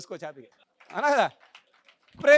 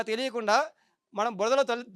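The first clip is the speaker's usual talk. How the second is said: normal talking voice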